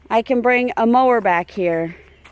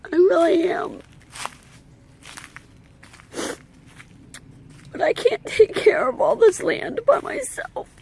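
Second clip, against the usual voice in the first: higher, strained voice on the verge of crying; holding back real tears while pleading for help; emotional, near tears; 7 semitones higher than usual. Real, not acted. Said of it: "I don't mean to get emotional".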